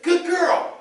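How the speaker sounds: high, bright praise to a dog; cheerful praise for the dog. Shown as a demonstration. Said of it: "More of a motivational".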